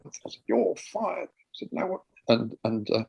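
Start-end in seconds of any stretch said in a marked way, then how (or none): 0.5-1.3 staged firing. Pretend. Fake firing in kitchen. 1.7-2.0 staged protest. Pretend. Staff reply in staged scene.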